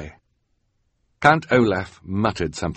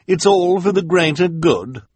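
high, louder sneering villainess; Esme mocking; 11 semitones higher, 6 dB louder than usual. A narrator's reading. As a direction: toss it off with scorn. Esme mocks henchman.

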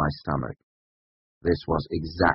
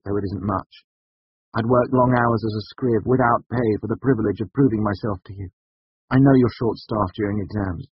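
desperate; 6 semitones higher, 5 dB louder than usual. A narrator's reading. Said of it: "unable to keep my voice entirely free of desperation".

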